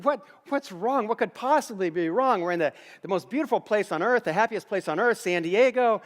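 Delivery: baffled husband, acted out with rising energy; retold bewilderment. Retold husband baffled by crying wife.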